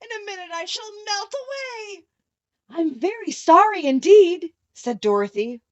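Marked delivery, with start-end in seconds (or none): 0.0-2.0 much higher, softer wail with a sob; the witch panicking as she melts; terrified crying. A narrator's reading. Melting witch wailing to Dorothy in terror. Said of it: "she screamed". 2.7-4.5 higher, louder alarmed apology; a scared child saying sorry; frightened apology. A narrator's reading. Dorothy apologizing to the melting witch, frightened. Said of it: "truly frightened".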